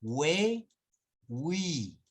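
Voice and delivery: "we, wi" with the U voiced as W; slow, stretched we and wi with rounded W glide; U sounded. Shown as a demonstration. Teacher showing what dieresis does, demonstrating. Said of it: "¿Notan la diferencia?".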